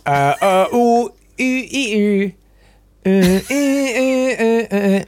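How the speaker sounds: high-pitched eager oohs and uhs; high, wordless straining to remember; straining noises. Real, not acted. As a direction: squeal as the answer nearly comes. Straining to recall quiz answer.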